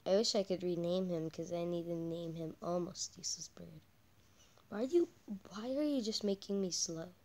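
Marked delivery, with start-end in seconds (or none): none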